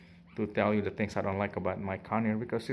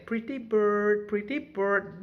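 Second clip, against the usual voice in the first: higher, slow sing-song parrot mimicry; 8 semitones higher, slower than usual. Owner mimics her parrot's morning wake-up call in a higher parrot voice. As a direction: copy the bird's sweet morning greeting. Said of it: "by saying".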